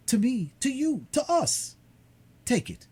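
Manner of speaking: high, loud happy son; joyful young man. Acted. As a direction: beam with joy and give freely. Dantès jubilant.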